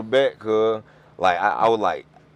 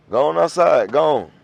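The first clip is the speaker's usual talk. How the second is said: loud, raised mock command; retold firm order; 5 semitones higher, 8 dB louder than usual. Adult shooing little boy outside, loud and insistent. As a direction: send the kid off loudly.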